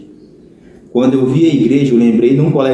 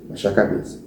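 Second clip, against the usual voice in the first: fading to quiet; 9 dB quieter than usual. Retold or imitated. Ashamed, lowering his head.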